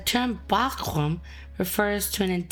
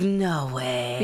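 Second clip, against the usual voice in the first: low "no way"; 8 semitones lower than usual. Shown as a demonstration. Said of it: "it's like".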